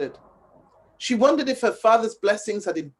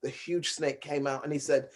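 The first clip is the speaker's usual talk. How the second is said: ordinary delivery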